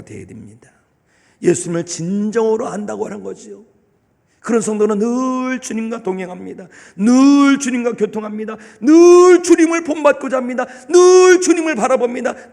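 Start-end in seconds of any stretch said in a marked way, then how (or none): 4.4-12.5 building from steady to high, loud proclamation; fervent sermon climb; preaching at full force. Acted. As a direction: drive each line home, rising.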